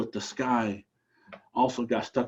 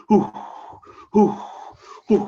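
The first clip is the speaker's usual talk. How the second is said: whooshing hoo; 7 semitones higher, 5 dB louder, faster than usual. Acted. Raven flying higher.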